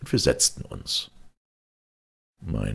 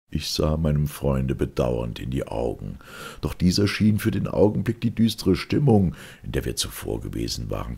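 plain reading voice

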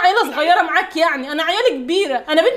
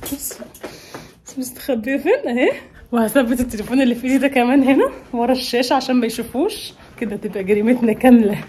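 usual speaking voice

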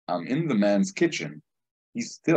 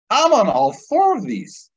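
high, loud, disbelieving; the penny drops with a yelp; shocked outburst; 14 semitones higher, 6 dB louder than usual. Retold or imitated. Cop realizing he takes all four drugs, shocked high outburst.

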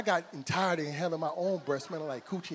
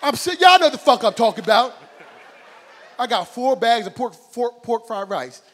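much louder, higher ranting shout; outraged shout; 5 semitones higher, 12 dB louder than usual. Real, not acted. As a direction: yell it out in comic outrage. Ranting about duck sauce.

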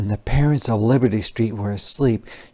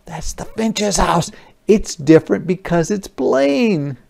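gasp, then high astonished realization; surprised discovery; 8 semitones higher than usual. Acted. Soldier spotting the plain house, astonished.

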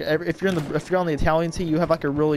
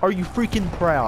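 heated, raised-voice rant; irate gamer outburst; 5 semitones higher than usual. Real, not acted. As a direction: hurl angry questions at a kill-stealer. Taunting player who took kill.

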